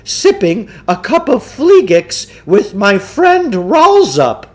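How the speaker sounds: pompous huckster lecturing his marks; Samuel Klugarsh voice. Acted. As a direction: play the self-assured mind-control huckster.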